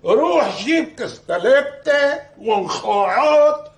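loud, high, drawn-out mockery; sarcastic echo. Man telling him to fetch the meats himself, sarcastic. Sarcastically repeats dishes wanted.

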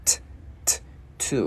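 TO built from two lone T clicks, then the word; tongue-released T. Shown as a demonstration. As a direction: pop the T twice, then say "to". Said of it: "Chữ T mình bật lưỡi". (bật lưỡi "T, t" rồi đọc "to")

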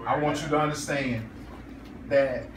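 tender declaration. Real, not acted. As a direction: say it tenderly, taking your time.

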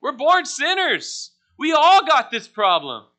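loud, slow, emphatic preaching; preaching hard. Acted. Preacher pressing the youth about the sinful heart, forceful. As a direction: drive the point home from the pulpit.

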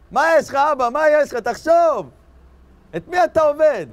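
loud, high-pitched acted rebuke; impassioned telling-off at full voice; exasperated scolding. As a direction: shout at your father to think. Acted son scolding his idolater father.

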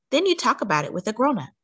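usual speaking voice

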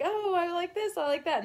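put-on high, bouncy child voice; kid voice. Imitating her childhood self, high and gleeful. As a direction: sound like a delighted little kid. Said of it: "when I was a kid".

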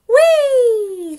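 loud, high, long whee, sliding down; gleeful whee. A narrator's reading. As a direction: squeal a giggly, joyriding whee. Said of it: "she cried".

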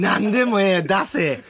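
hammed-up insistence, pushing a partner to deliver; playfully bellowed demand; mock demanding shout. Mock demand for a tsukkomi line.

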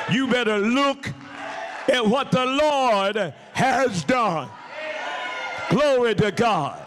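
high, ringing triumphant proclamation; full-force preaching. Real, not acted. Preacher proclaiming God's victory over death, triumphant full-force preaching. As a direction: preach it high, stretching the key words.

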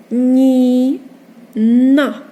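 Ні held long, pause, then loud на; split into syllables. Shown as a demonstration. Teacher reading a two-syllable word, Ні and на loudly separated. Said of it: "Читаємо слово із двох складів".

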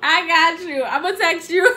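loud, high, laughing; cracking up at the victim; gleeful gloating. Real, not acted. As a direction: crow in triumph through real laughter. Gloats to friend after prank reveal.

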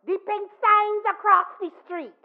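loud, high challenging question; soft loud voice. Retold or imitated. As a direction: call from the store doorway. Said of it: "in that soft, loud voice".

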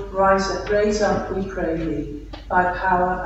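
usual speaking voice

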